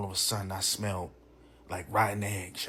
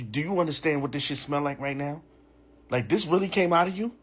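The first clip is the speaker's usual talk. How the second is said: rising to high, louder incredulity; indignant disbelief; 5 semitones higher, 3 dB louder than usual. Retold or imitated. Man confronting date about the smell, appalled and higher. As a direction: re-enact an appalled demand, climbing higher. Acted quarrel over the smell.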